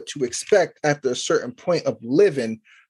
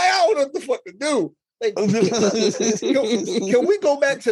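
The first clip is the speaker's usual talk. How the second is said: much higher, hammed-up crying whine; mock despair; 6 semitones higher than usual. Dad retelling his helplessness with his daughter, mock crying. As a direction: whimper it like you're about to break. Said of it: "I'd be like".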